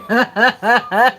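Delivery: high, loud burst of laughter; loud real laugh. Real, not acted. Laughing at being fired after quitting. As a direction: crack up at it.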